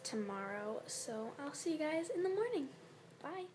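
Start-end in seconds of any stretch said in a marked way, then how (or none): none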